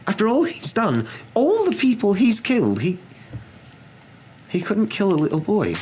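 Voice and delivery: high-pitched, overwhelmed disbelief; faltering. A narrator's reading. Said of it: "faltered Professor McGonagall".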